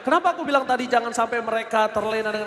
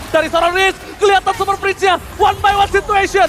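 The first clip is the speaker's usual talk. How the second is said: rising, louder fight call; excited play-by-play; 6 semitones higher, 7 dB louder, faster than usual. Real, not acted. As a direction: build the hype fast. Fight breaks out, caster building. (komentar makin heboh)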